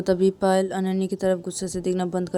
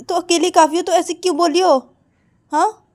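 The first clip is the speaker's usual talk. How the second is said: taunting sister; 10 semitones higher, 8 dB louder than usual. Acted. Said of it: "तो तभी पायल बोलती कि".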